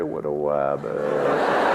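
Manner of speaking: low, very slow lisping cartoon hunter. Comic making the crowd guess the cartoon, low slow lisp. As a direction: drop low and drag it out. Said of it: "But this one you gotta guess".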